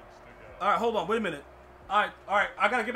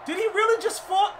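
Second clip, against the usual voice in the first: much higher, louder, slow stunned yell; incredulous shout; 10 semitones higher, 9 dB louder than usual. Real, not acted. Player shouting at his football game, shocked disbelief. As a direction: stretch out the disbelief in a big yell.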